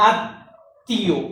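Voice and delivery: আত্মীয় drawn out slowly as "at-tio"; syllable-split pronunciation. Shown as a demonstration. Teacher showing students where the hasanta goes, syllable-split pronunciation. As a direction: say আত্মীয় slowly, no vowel after the first t. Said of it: "এরকম কোনো কিছু উচ্চারিত হয় নাই".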